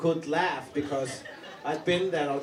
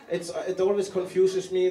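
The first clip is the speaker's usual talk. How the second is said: usual speaking voice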